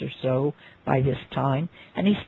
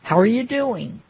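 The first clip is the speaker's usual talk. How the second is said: put-on quick, higher New York accent greeting; imitating a New Yorker's accent, a touch higher; 6 semitones higher, 4 dB louder than usual. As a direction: toss off a breezy New York hello. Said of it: "with a Nor-, New York accent".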